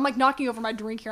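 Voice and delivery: ordinary talk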